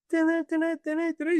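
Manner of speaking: high, quick beeping heartbeat sound; imitated heart monitor. Patient imitating the monitor as his heart races, beeping. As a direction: mimic a racing heart monitor. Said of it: "The monitor was like".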